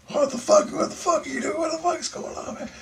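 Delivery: high, alarmed cousin voice; startled cousin. Retold or imitated. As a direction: play the woken cousin, rattled.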